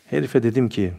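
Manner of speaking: usual speaking voice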